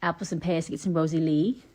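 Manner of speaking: Cockney rhyming-slang voice; Cockney accent. Shown as a demonstration. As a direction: put on an East London Cockney accent.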